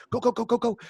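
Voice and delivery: high-pitched rapid-fire imitation; mock frantic urging. Kitchen rushes the waiter out with lobsters, high mock urging. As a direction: hurry them along in a high, squeaky voice.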